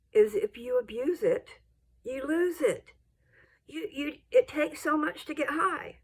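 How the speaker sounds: ordinary talk, nothing marked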